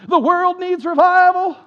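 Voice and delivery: mock revivalist, loud and high; slow, hammed-up pulpit cry; eighties Southern Baptist preacher. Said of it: "preach like Southern Baptists from the eighties".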